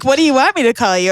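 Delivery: mock clerk. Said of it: "He's like".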